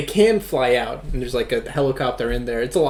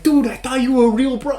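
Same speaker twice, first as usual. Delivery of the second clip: mock high-pitched hurt whine; a hammed-up high voice, feelings hurt; hurt friend; 10 semitones higher than usual. Martin hurt by goon quitting.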